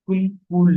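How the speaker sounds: কুল brief, then কুল held long, high and loud; short then long. Shown as a demonstration. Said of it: "এভাবে".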